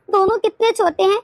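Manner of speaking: ordinary delivery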